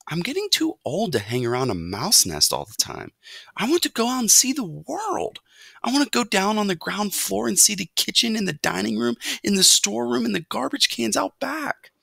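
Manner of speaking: insistent youngster, pitch climbing high; restless young mouse. A narrator's reading. Young mouse longs to see the world, eager insistence. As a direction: plead your case with mounting eagerness. Narrator voices restless Ralph.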